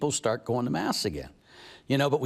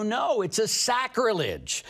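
retold protest; 8 semitones higher, slower than usual. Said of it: "and I go".

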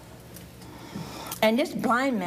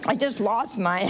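real laughing fit swallowing the words; breaking into laughter; 6 semitones higher than usual. Real, not acted. Preacher losing the thread before the audience, laughing. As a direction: lose the sentence to laughter.

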